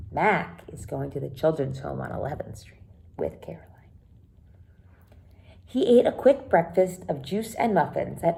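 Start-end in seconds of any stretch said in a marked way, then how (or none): 0.1-3.9 whispered, as if sharing a secret. Shown as a demonstration. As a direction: lean in and whisper it to the class.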